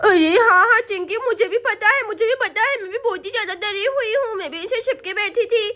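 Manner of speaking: put-on lisping little girl confiding fear; worried child voice with babyish sounds; frightened little Sonam. Girl admits she was hiding too, frightened child voice.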